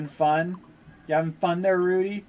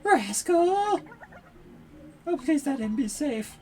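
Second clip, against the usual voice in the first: high, pleading mother voice; worried mother; 9 semitones higher than usual. Acted. Mother begging the adventurer for help, high pleading. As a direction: beg the hero to save her pet.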